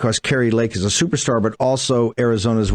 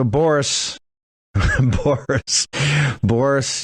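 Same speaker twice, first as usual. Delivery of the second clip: chuckling through the name; a laugh breaks into the name; 3 semitones higher, much slower than usual. Real, not acted. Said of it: "I'm not laughing 'cause it's".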